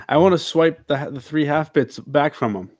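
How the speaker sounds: ordinary delivery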